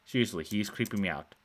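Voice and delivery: plain reading voice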